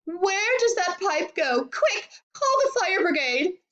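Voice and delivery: high, wailing panic; frantic high-pitched cries; yelled. A narrator's reading. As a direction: screech for help, stretching the words. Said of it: "yelled Missus Globe".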